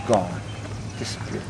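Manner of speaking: soft, dropping ghost-story hush; spooky ghost tour hush. Acted. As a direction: let the words fade away.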